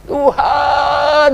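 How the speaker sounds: high, loud cry to God; shouted prayer. Retold or imitated. Man alone in the shower calling to God, shouting high. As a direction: cry out at full voice, drawn out. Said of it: "saya teriak-teriak sama Tuhan gitu".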